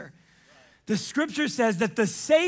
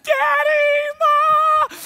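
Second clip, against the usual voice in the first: put-on very high, loud child's cry of joy; kids crying out; 9 dB louder, much slower than usual. Children calling to their parents, very high and loud. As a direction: call out like an overjoyed child. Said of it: "the children are like".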